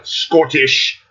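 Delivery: slow Sean Connery style Scottish; Scottish accent. Shown as a demonstration. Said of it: "like fucking Sean Connery".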